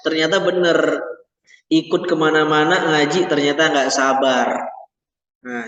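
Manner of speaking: ordinary talk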